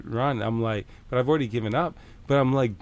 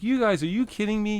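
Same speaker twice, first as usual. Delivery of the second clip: high, indignant "are you kidding me"; retold fury, pitched up; mock indignation at the cops; incredulous outrage; 10 semitones higher than usual.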